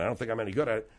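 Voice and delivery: usual speaking voice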